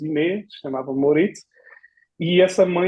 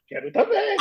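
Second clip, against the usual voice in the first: laughing shout; 3 dB louder than usual. Retold or imitated.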